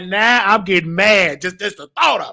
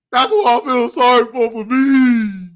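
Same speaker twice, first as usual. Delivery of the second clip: mock sobbing. Mock crying self-pity.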